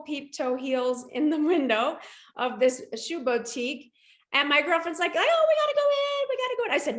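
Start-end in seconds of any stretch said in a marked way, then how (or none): 5.1-6.7 high, excited coaxing voice; giddy pleading friend; eager girlfriend. Retold or imitated. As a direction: pull her friend into the shop. Girlfriend urging into shoe shop.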